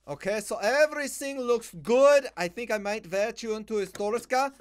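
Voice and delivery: pompous Eastern European border-guard act; mock Eastern European accent. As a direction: wave them through in a thick put-on Slavic accent. Playing inspector in mock accent.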